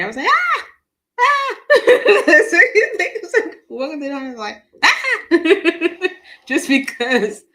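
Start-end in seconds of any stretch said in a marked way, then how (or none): 0.3-1.6 short high-pitched mock screams; loud, playful yelps of fright; imitated scream. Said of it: "how many times I screamed". 4.8-6.1 high, loud mock scream; playful yelp of fright; imitated scream. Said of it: "how many times I screamed".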